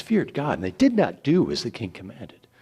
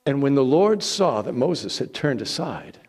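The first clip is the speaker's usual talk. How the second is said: ordinary delivery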